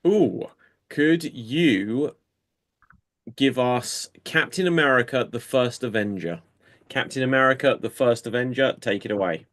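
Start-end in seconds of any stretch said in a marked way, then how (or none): none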